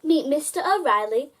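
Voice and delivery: ordinary delivery, nothing marked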